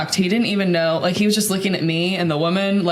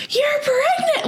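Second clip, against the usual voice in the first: high-pitched shriek of surprise; slower than usual. Retold or imitated. Old woman shrieking at pregnant stranger in store. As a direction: shriek it like spotting an old friend. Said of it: "she screams, like, shrieks".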